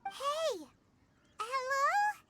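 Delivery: calling to rouse. Acted. Trying to rouse an unconscious man.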